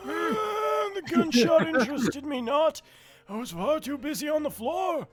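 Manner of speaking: put-on old man voice; cackling excited old man. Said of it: "your old man voice".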